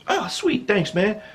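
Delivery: high, softened little thanks; hammed-up high-voiced gratitude; meekly grateful. Thanking the friend who stood up for him, high comic gratitude.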